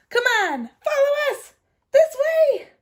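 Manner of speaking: high, loud urging; urgent friends calling. Acted. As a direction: hurry her along.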